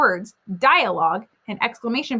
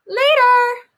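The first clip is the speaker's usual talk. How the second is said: high, loud call after him; called back; 13 semitones higher, 7 dB louder than usual. Acted. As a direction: call it back over your shoulder. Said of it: "she called back".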